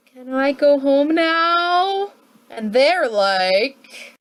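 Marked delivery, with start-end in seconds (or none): 0.1-2.1 higher, slow, weary little plea. Retold or imitated. Washington to Congress after four years, slow tired plea. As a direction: beg to go home, drawn out. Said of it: "he's just like".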